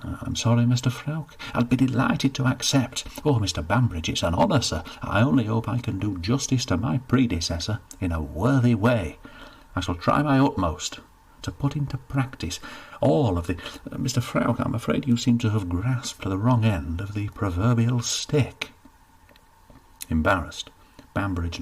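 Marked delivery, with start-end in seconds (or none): 0.0-13.6 put-on overexcited young man, higher and louder; keen lad thanking the steward too soon; eager Cedric. 13.9-18.7 embarrassed official correcting a mistake; a hint of pompous embarrassment; awkward steward.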